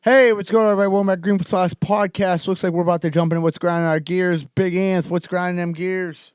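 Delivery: high, loud show opener; hyped podcast host intro. Acted. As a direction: pump up the crowd to start the show.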